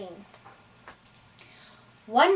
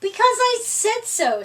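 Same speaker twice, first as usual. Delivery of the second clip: bossy retort; 9 semitones higher, 4 dB louder than usual. A narrator's reading. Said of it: "said Bunny".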